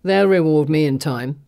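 a man's gruff villain voice. Acted. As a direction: play the coarse kidnapper. Villain Falson speaks.

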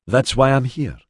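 put-on northern Mediterranean accent, pitched high. A narrator's reading. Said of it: "a strong northern Mediterranean accent".

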